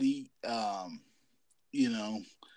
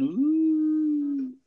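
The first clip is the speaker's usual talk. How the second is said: high, loud, drawn-out ghost moan; ghostly moan; 7 dB louder than usual. Retold or imitated. Grandma sneaking in to scare him, high ghostly moan. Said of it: "starts going".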